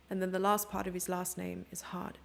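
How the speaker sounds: usual speaking voice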